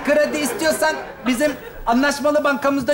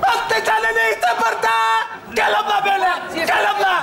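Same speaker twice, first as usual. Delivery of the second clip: raised, sustained vendor call; market hawker's cry; 4 semitones higher than usual. Acted. Aubergine seller beckoning a passing woman, hawker's cry. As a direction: call shoppers over to the stall.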